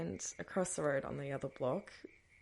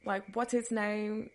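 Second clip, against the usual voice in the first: higher, pressing demands; retold yelling; 5 semitones higher, slower than usual. Mother interrogating her daughter through open windows, mock yelling. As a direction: fire the questions like a suspicious mum. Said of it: "starts yelling at me".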